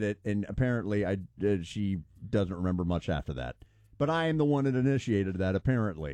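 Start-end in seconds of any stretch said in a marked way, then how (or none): none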